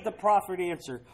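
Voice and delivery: usual speaking voice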